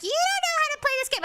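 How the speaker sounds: very high, fast mock yelling; irate teammate imitation. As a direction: screech it like a mad teammate. Imitating angry League teammates.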